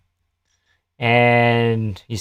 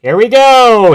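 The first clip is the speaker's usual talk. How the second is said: fight announcer; 9 semitones higher, 9 dB louder, slower than usual. Pretend. Fight starting again.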